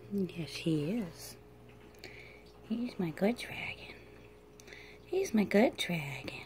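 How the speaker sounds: hushed, breathy whisper to a sleepy pet; soft whisper. Real, not acted. Owner whispers to her drowsy dragon, soft whisper. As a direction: whisper so he drifts off.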